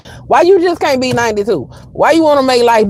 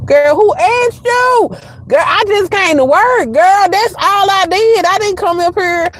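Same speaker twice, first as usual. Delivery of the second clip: exasperated retort; 10 semitones higher than usual. Retold or imitated. Retorting to the coworker about her makeup, high and exasperated. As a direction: fire back high and fed up.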